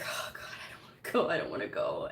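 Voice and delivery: whispered, dreading it; whined dread. Retold or imitated. Daughter dreading the move, whispering. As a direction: whisper it like you dread going.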